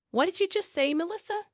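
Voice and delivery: high-pitched, affronted question; indignant challenge. A narrator's reading. In-laws challenge wife after her insult, indignant.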